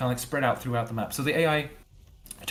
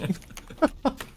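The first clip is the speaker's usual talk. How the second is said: breathy unvoiced chuckle; quick airy laugh up high; 14 semitones higher than usual. Real, not acted. Laughing at chat joke.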